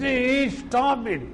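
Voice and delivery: mock yell as if being crushed; retold pained shout. Speaker re-enacting his plea during a crushing handshake, mock pained shout. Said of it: "I shouted as if I am going to die".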